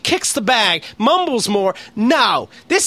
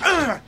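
high mock huff of annoyance; annoyed grunt; 8 semitones higher than usual. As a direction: huff like a grumpy passenger. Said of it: "and go".

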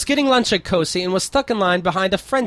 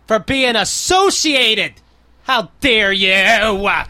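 mock outrage; 3 semitones higher, 5 dB louder, much slower than usual. Mock outrage at French lovers.

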